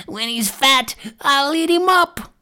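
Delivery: high, drawn-out crone voice; gloating witch. A narrator's reading. Witch tells Gretel her plan for Hansel, gloating. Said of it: "and cried".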